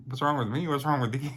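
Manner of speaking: high, loud, disbelieving comeback, question rising; incredulous retort. Retold or imitated. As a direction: throw the question back at him, fighting laughter. Laughing retort to dad.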